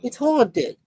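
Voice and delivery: hammed-up Australian accent, high on the exclamation; Aussie accent. Joking to chat about the haunted rock, mock Aussie accent. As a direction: put on a rough-and-ready Aussie accent. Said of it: "Not my best Aussie accent".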